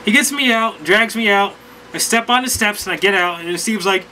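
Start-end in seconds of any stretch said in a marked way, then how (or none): none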